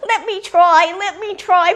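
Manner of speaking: high, loud, eager little bird; pleading young grebe. A narrator's reading. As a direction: beg like a youngster desperate for a turn. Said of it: "The young grebe came forward and he said".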